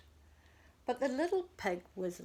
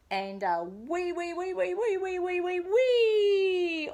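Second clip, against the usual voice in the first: high, louder squealing cry; squealing wee wee; 9 semitones higher, 6 dB louder than usual. A narrator's reading. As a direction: squeal it like a fleeing piglet. Pig squealing all the way home.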